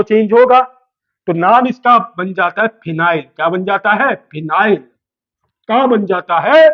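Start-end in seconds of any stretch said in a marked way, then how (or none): 4.8-6.7 slow, stretched question rising at the end; drawn-out prompting question. Real, not acted. Teacher asks the class what the name becomes, drawn-out prompt. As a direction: draw it out so the class fills in.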